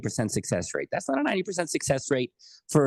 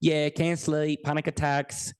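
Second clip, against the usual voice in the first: bad Australian accent; 3 semitones higher than usual. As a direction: imitate an Australian oil rig worker, accent badly done. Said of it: "this is my really bad Australian accent".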